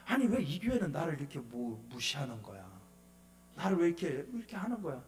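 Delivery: low, quietly resentful muttering; retold grumbling. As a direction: grumble to yourself, keeping it low. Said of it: "알고 나니까 기분 나쁘더라고". (낮게 혼잣말로 투덜대듯)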